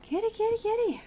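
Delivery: high sing-song cat call. Real, not acted. Calling the cat.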